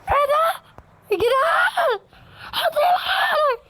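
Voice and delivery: high shrieking with laughter; high laughing shriek of surprise; shocked squeal. Real, not acted. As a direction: squeal half in fright, half laughing. Sudden shock in the game.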